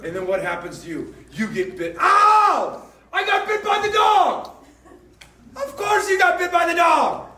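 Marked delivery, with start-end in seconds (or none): 2.0-4.5 high, loud yelp of pain. Retold or imitated. Someone poking the dog gets bitten, yelping loudly. 5.6-7.3 scolding shout. Acted. Preacher scolds congregation.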